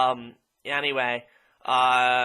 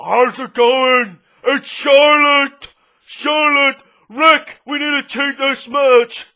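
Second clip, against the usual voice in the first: slow, loud, higher mannish bellow with a put-on Austrian accent; mocking Charlotte; 5 semitones higher, 6 dB louder, slower than usual. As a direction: bellow it like a muscleman. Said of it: "That's what she fucking sounds like".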